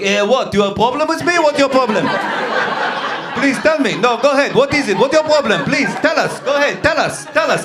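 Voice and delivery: goading someone to speak up; confrontational Israeli. Retold or imitated. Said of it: "Israelis are like".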